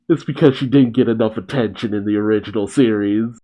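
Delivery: ordinary talk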